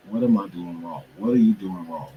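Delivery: normal talking voice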